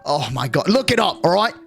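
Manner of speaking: mock elderly exasperation; exasperated old man. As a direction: imitate a fed-up old man telling someone off. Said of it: "He's like".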